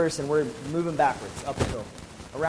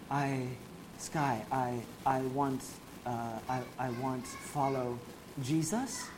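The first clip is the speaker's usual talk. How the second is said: quiet, halting, a bit slow, every phrase lifting like a question; soft, hesitant imitation; 3 semitones lower, 9 dB quieter, much slower than usual. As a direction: gently imitate a shy friend groping for English words. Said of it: "he speaks real soft".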